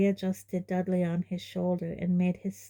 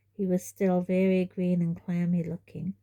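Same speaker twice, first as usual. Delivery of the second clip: ordinary delivery, nothing marked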